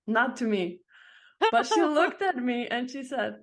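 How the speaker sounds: normal talking voice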